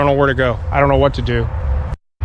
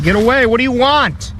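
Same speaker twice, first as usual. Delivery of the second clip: high, urgent call at intruders; scared yell; 7 semitones higher than usual. Real, not acted. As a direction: drive them away from the tent. Threatened camper yelling at intruders.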